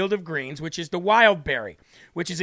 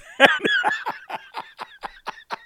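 high-pitched laugh. Real, not acted. Laughing at own parody.

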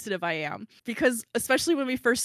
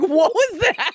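laughing through a high, louder question; giggling question; 9 semitones higher, 7 dB louder, slower than usual. Retold or imitated. Friend reading an odd text, giggling in disbelief. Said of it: "giggling, like".

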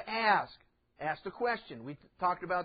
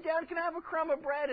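put-on high, small child's plea; a hint of a begging kid; child asking; 9 semitones higher than usual. Preacher voicing a kid asking for a crumb of bread, high pleading.

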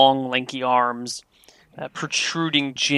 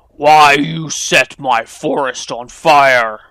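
ogre voice; 8 dB louder, slower than usual. Acted. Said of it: "The ogre squints his beady eyes at you and says".

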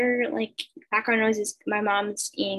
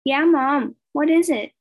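louder, higher reply; yelling back; 5 semitones higher, 6 dB louder, slower than usual. A narrator's reading. Girl answering her mom from bed, yelled reply. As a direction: call back to the kitchen. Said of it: "I yelled back".

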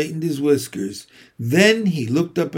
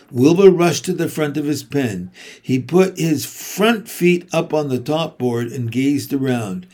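ordinary delivery, nothing marked

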